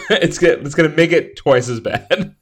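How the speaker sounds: high, loud words through laughter; real giggles breaking the words; laughing. Real, not acted. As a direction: laugh it out as you speak.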